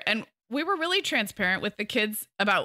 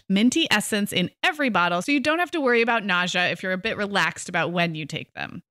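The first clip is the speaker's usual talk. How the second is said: loud, bright sponsor read; 5 dB louder than usual. Acted. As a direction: pitch the product cheerfully. Reads sponsor ad.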